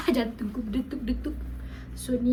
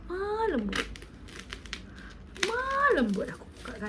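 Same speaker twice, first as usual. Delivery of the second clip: delicate, high-pitched care; gentle; 7 semitones higher, 5 dB quieter than usual. Real, not acted. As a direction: coax it open very gently. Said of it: "Kita kena buka dengan lemah lembut".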